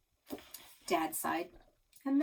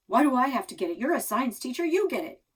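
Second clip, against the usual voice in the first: louder, rattled arguing; panicked protest; 5 semitones higher, 8 dB louder, faster than usual. A narrator's reading. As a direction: push the job back, flustered and loud. Dad argues back, panicked.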